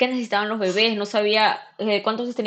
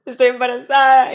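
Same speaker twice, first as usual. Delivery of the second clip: louder, raised confession; dramatic announcement; 4 semitones higher, 8 dB louder than usual. Retold or imitated. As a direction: blurt it out to mom. Anuncio dramático a su madre. (anuncio en voz alta y dramática)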